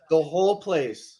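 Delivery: ordinary talk, nothing marked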